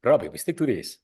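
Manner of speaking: imitating a Spanish friend's lisp; light Spanish accent with a lisp. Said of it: "with the Spanish lisp".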